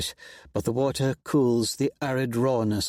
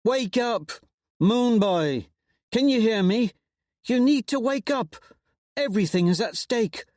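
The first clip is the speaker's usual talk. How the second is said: much higher, pleading and urgent; urgent rousing; 11 semitones higher than usual. A narrator's reading. Pytho to Lysander, urgent and worried. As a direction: shake him awake with words.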